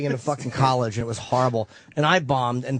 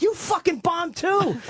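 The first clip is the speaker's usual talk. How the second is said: high, drawn-out mock protest; indignant comeback; 12 semitones higher, slower than usual. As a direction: throw it back at him, higher and slower. Retelling his indignant comeback.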